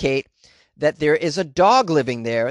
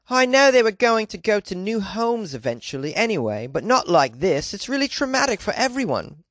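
put-on British accent; 5 semitones higher than usual. Dog owner quoted on her stolen puppies, mock British accent. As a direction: imitate an upset British woman, slowing mid-phrase. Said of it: "I'm supposed to be in the U K".

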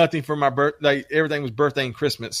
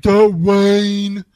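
"Dwayne Johnson" drawn out, higher and louder, insisting; a kid slowly insisting on the full name; imitating his daughter; 6 semitones higher, 6 dB louder, much slower than usual. Dad voicing daughter correcting him, higher insistent "Dwayne Johnson". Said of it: "she's like".

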